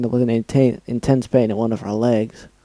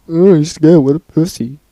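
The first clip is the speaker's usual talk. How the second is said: loud sneering taunt; mocking the frightened reader; 4 semitones higher, 7 dB louder than usual. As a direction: jeer at the scared reader. Mocking himself in another voice.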